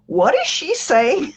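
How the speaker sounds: high, slow baffled question; a light imitation of a puzzled shop assistant; puzzled Walmart man. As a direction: ask it slowly, high and confused. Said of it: "said to her".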